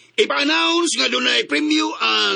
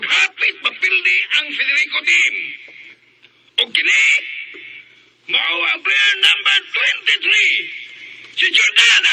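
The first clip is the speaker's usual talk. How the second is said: louder and higher, announcing the winner; rousing announcement; 6 semitones higher, 4 dB louder than usual. Acted. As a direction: call out the winner's name to the crowd. Father announces winner to crowd. (madasigon nga pahibalo)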